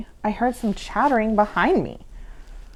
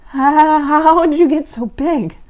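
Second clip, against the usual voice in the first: gasped; 6 semitones higher, 6 dB louder than usual. Acted. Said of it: "I gasped".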